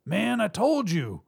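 high, slow, exasperated call; shouted. A narrator's reading. Robber by door to partner, exasperated shout. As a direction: protest across the room to your partner. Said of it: "Congo shouted from beside the door".

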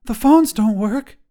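put-on shocked child catching her breath; startled little girl, slowed with disbelief; gasped. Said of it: "Jacayla gasped".

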